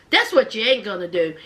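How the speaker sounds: low, fast warning, imitating the sister; defiant sister. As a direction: lay it down low and quick. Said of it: "And the sister's like this".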